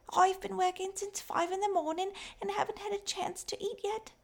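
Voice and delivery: put-on high, thin, raspy little girl voice. A narrator's reading. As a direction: call out like a tired, skinny child selling coal. Fanny the mine girl speaks.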